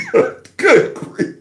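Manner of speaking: good grief. Retold or imitated. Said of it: "I'm like".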